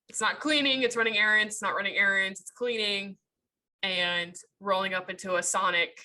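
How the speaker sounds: normal talking voice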